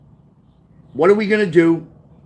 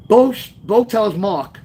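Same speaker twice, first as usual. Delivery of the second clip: ordinary delivery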